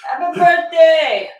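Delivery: loud, slow birthday shout; drawn-out cheer. Real, not acted. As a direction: stretch it out joyfully. Repeats the cheer.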